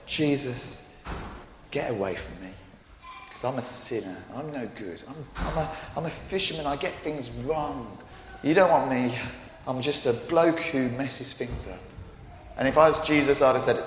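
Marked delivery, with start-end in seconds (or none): 0.1-11.8 ashamed confession. Acted. Simon kneeling before Jesus, quietly ashamed. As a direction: lower and softer, a humbled Simon. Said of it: "He said".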